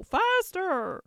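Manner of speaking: high, loud call to hurry; urging cry. A narrator's reading. Queen urges Alice to run.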